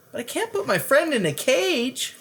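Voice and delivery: crying out. Acted. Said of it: "cried Lewis".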